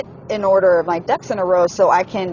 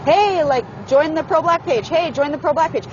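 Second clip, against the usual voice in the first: higher-pitched, insistent mimicry; imitated nagging; 7 semitones higher than usual. Quoting the man's repeated page invitations, mock nagging. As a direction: pester repeatedly, imitating someone who won't let up. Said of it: "kept on me about the shit over and over".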